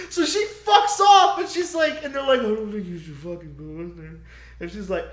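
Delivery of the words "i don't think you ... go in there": soft, quick hushed warning. Retold or imitated. Elders warning Danny not to go in, soft quick hush. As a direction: keep her away, quietly.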